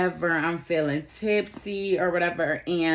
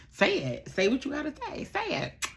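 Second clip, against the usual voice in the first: soft, high coaxing voice, climbing higher; imitated coaxing date; 12 semitones higher, 4 dB quieter than usual. Date urging a confession between kisses, mock soft high coaxing. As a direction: coax it out softly in a hammed-up high voice.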